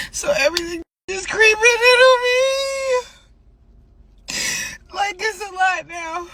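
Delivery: real tears coming through; crying. Real, not acted. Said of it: "I don't know why I'm crying".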